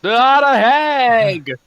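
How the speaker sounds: high, loud, drawn-out wail; hammed-up cry of dismay; mock horror. Player acts horror at the hag.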